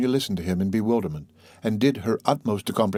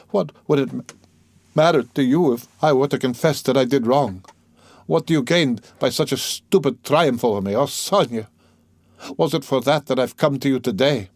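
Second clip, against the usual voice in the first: higher, agitated reproach; mild despair; 5 semitones higher, 4 dB louder than usual. A narrator's reading. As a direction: plead and reproach her at once. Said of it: "with a sort of despair".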